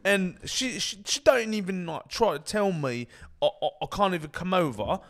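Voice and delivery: high, ranting British lad; mock British accent. Lad moans about streaming and his nan, mock British accent. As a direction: open loud in a hammed-up British accent. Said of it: "it's an easy impression".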